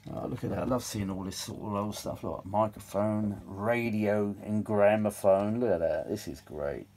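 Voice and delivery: ordinary talk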